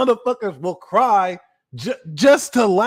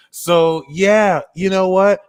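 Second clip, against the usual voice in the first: normal talking voice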